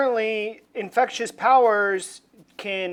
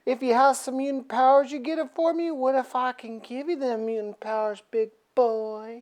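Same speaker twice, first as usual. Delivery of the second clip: sultry tease; 4 semitones higher, 6 dB quieter than usual. Retold or imitated. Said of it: "She's like".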